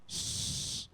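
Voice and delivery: an unvoiced shh for silence; quiet them with a shh; hushing the class. Real, not acted. Teacher silences the class, unvoiced shh. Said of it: "Now listen to me".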